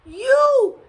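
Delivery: loud, high-pitched "You"; exasperated father. A narrator's reading. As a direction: shout it back in exasperation. Father answers, losing patience.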